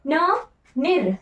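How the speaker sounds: drawn-out syllables न निर; teacher slowly spelling out न निर; slow syllable demo. Shown as a demonstration. Teacher showing students the spelling, spelled out slowly. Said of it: "र भी होता है निर्भ्रांत".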